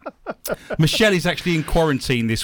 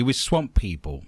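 normal talking voice

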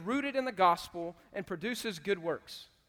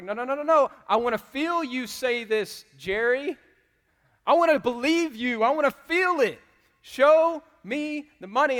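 rising excited shout; 6 semitones higher, 10 dB louder than usual. Retold or imitated. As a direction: pump him up until he yells. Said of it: "He's like".

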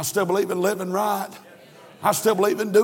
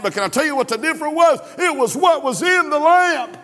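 higher and louder, shouting the build; fiery preaching; 8 semitones higher, 4 dB louder than usual. Acted. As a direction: preach it out over the congregation. Preaching at full force.

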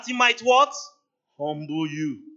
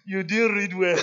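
mock crying whine; 3 dB louder than usual. Student whines to the pastor that he marked badly, mock crying. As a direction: whine it like a sulking student near tears. Student complaining after failed exam.

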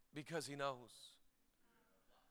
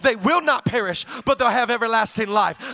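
preaching at full force; 3 semitones higher, 6 dB louder than usual. Acted. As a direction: build it up to a shout. Preaching climax, loud and sung.

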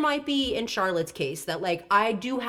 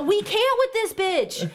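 emphatic agreement; 10 semitones higher, slower than usual. Real, not acted. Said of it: "But I do".

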